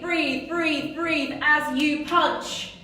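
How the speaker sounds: ordinary talk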